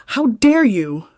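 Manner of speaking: an outraged mother-in-law lashing out; furious matriarch. Acted. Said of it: "It was a low, vicious hiss".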